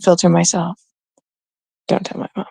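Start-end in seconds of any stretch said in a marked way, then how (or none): 1.9-2.5 hushed aside. Real, not acted. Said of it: "secretive aside".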